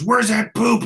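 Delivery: imitating a furious squadmate hunting the smell; mock angry yell. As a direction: bark it like you're fed up with the stink. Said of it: "getting mad".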